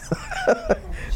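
quick high amused laugh. Real, not acted. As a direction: laugh at the obvious. Laughing at the people's confession.

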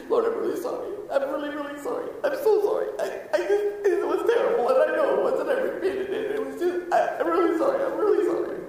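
high, laughing, frantic apology; anxious groveling apology. Pretend. Anxious soul apologizing to Jesus in heaven, high and frantic. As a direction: babble nervous sorries to Jesus. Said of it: "conversation that kinda goes like this".

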